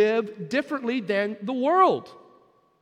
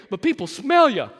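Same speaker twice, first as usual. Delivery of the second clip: emphatic punch; 2 semitones higher, 8 dB louder than usual. Real, not acted.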